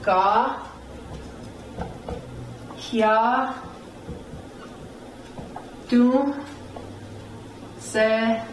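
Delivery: "Ga, hya, Do será" with long pauses between syllables; teacher's separated "Ga, hya, Do será". Shown as a demonstration. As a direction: sound out "Ga, hya, Do será" one piece at a time. Teacher sounds out new word.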